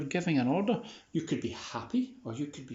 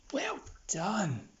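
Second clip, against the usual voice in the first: whispered praise; 8 semitones higher than usual. Real, not acted. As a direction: breathe the praise without voice. Praising the dog quietly.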